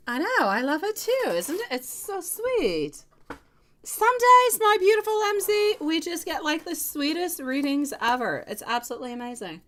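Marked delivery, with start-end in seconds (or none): 0.1-8.4 much higher and louder, gushing delight. Real, not acted. Reader gushing to M Z about the sweetest readings, high and excited. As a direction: squeal with delight, pitch way up.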